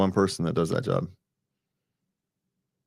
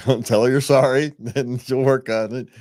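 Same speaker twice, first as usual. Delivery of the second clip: laughing; 6 semitones higher, 5 dB louder than usual. Retold or imitated. Jokingly quoting advice to men.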